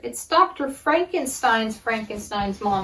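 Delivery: ordinary talk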